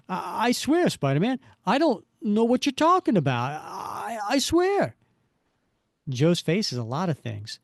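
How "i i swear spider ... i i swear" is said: high stammering plea; frightened pleading. Acted. Joe Face swearing to Spider-Man, high frightened whine.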